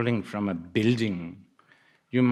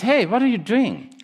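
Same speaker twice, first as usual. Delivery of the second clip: high, loud startled question; acted loud, puzzled hail; calling out; 12 semitones higher, 8 dB louder than usual. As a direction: call to a friend dropping past you. Friend calls to the falling person.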